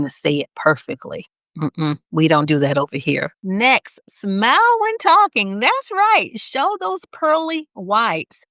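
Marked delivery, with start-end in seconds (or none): 4.1-8.4 high, lilting smile in the voice; audible smile. Shown as a demonstration. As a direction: talk through a big smile. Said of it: "you can hear my smile through my voice".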